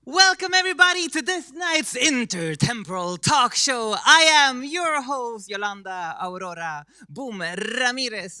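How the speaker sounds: loud, high-pitched, big welcome; show host announcer. Acted.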